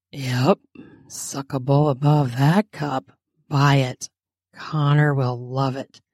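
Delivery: low husky character voice; huskier voice. A narrator's reading. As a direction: drop the pitch, a little husky. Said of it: "in a somewhat huskier voice".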